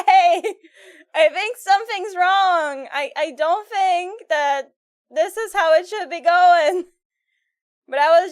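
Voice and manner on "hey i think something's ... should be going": higher, louder, drawn-out retold call; calling out alarm. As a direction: act out slowly warning that something is wrong. Acts out alerting others mid-ride.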